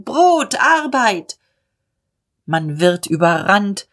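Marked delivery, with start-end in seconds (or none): 0.0-1.4 much higher, shrill protest call; women crying out like signals; shrill women's cry. Acted. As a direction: pitch it up high over the noise. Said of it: "Weiberstimmen im Lärm, schrill, gleich Signalen".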